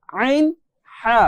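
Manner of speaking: আইন and হা pressed from mid-throat; pressed demo. Shown as a demonstration. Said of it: "একটু চাপ দিয়ে পড়ার চেষ্টা করেন". (মাঝখান থেকে একটু চাপ দিয়ে আইন, হা)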